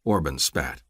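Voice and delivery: ordinary delivery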